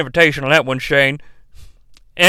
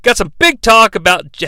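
ordinary delivery

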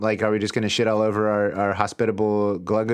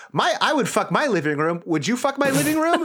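hammy host voice; 12 semitones higher than usual. Pretend. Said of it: "he says".

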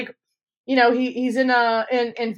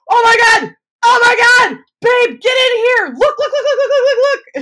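excited yell; 13 semitones higher, 8 dB louder than usual. Retold or imitated. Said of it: "I'm like".

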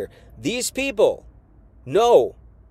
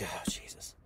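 exasperated sigh; 16 dB quieter than usual. Retold or imitated. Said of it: "He's like".

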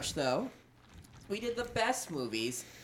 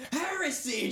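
mock yell; 9 semitones higher than usual. Shown as a demonstration. Co-host showing what to yell, high mock cry. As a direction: cry it out like an accuser. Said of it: "Just yell".

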